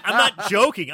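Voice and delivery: laughing while talking. Real, not acted. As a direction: say it through a laugh.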